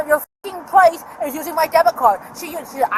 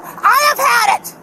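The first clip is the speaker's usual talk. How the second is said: a real angry outburst at the officer; angry yell; 8 semitones higher, slower than usual. Real, not acted. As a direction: yell it out, fed up and done. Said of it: "you don't need to yell at me".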